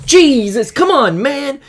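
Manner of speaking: high, loud frustrated outburst; real exasperation. Real, not acted. Player complaining at the card he got, exasperated outburst. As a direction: burst out in protest at rotten luck. Reacts to a bad game result.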